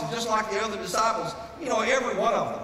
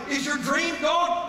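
higher, slow climactic cry; shouted question; 8 semitones higher, slower than usual. Acted. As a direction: cry it out at the peak. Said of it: "repeated, building to shout".

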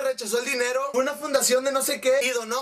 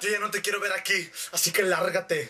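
low, dismissive man's voice; Arturo; 5 semitones lower than usual. Retold or imitated. Arturo throws Teresa out, low and dismissive. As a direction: send her away in a put-on low voice. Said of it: "y Arturo le dijo".

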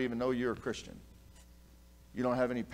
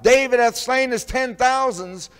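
loud, slow, much higher crowd chant; crowd cheering; 10 semitones higher, 13 dB louder, slower than usual. Retold or imitated. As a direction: belt it like the crowd hailing a hero. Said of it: "the one they cried out".

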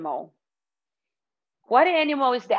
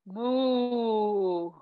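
long, soft imitated moo; cow moo; 5 dB quieter than usual. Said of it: "And cow".

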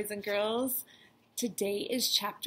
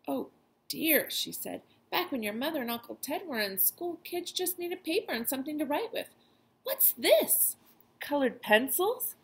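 sighing grandma; 7 semitones higher than usual. Acted. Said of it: "she sighed".

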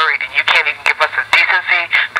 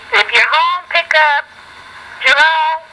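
high calling out; 10 semitones higher, slower than usual. Real, not acted. Calling out to pick up.

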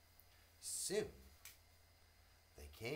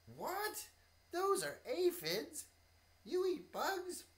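much higher, louder, slow disbelief; shocked bee; 11 dB louder, slower than usual. Acted. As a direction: gasp it out in disgust. Bee shocked by bug eating.

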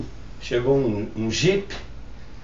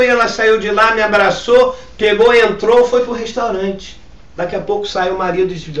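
ordinary talk, nothing marked

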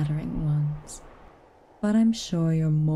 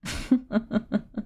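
amused laugh; 5 semitones higher than usual. Acted. Amused mistress laughs at girl.

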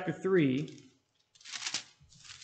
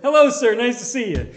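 put-on higher, louder, overly polite young man's hello; polite greeting; 5 semitones higher, 7 dB louder than usual. Young soldier greeting wife's family, high and loud. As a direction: greet the elders stiffly and politely.

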